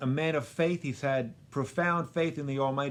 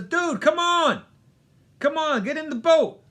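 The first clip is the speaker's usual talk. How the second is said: much higher, louder pleading shout; urgent call; 13 semitones higher, 8 dB louder than usual. Retold or imitated. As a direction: yell at him to get in the boat. Sean Penn urging from boat.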